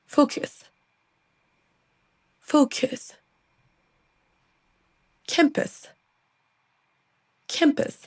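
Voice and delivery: isolated English words, unstressed vowels reduced to schwa; schwa drill. Shown as a demonstration. Learners listen and repeat, English schwa pronunciation. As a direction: clear word-by-word English model with weak vowels. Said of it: "c'est un son schwa".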